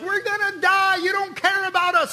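high, loud cry of terror; hammed-up panicked crowd wail; panicked screaming. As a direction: scream like you're about to drown. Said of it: "people screaming".

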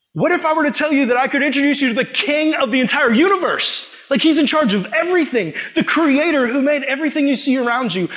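high, building grand offer; emphatic pitch. Retold or imitated. Evangelist offering a lady the King of the universe, fervent. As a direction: sell it big, with rising fervour.

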